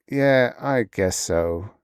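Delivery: slow, reluctant admission. Acted. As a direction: drag it out reluctantly. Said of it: "he admitted slowly".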